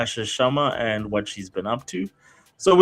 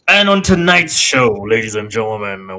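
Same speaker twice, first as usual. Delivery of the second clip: wrestling announcer voice; 7 dB louder than usual. Pretend. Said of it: "that's my wrestling announcer voice".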